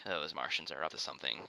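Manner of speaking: lightly voiced sneaky advisor; mock vizier. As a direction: murmur it like a scheming Jafar. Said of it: "vizier is like".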